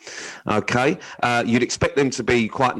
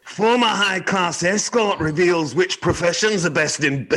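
high, slow attempt at a Scottish accent; bad Scottish accent; 8 semitones higher than usual. Shown as a demonstration. Host tries the headline on co-host's request, bad Scottish accent. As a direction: attempt Scottish, badly, pitched up and drawn out. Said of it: "I'd like you to try a Scottish accent".

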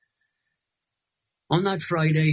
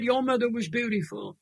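tearful; 7 semitones higher than usual. Real, not acted. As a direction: let real tears catch in the voice. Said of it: "I'm gonna cry now".

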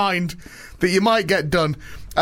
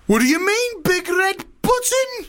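much higher, slow, alarmed hammed-up Russian accent; mock Russian Putin; slower than usual. Putin hears about the big red button in a high, hammed-up Russian accent. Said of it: "probably a Russian one".

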